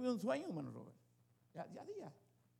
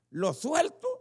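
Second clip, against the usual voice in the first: louder inner voice; 12 dB louder, slower than usual. Retold or imitated. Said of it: "Y decía yo entre sí".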